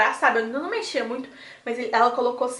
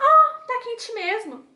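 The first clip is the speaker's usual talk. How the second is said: much higher and very slow, drawn-out admission; imitating the nurse; 10 semitones higher, much slower than usual. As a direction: stretch it out up high like the surprised nurse. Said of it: "falou assim".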